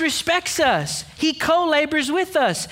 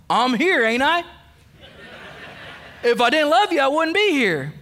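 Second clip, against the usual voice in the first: Uncle Joe impression. Preacher mocks the husband who won't say love, a hint of gruff uncle. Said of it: "That's Uncle Joe".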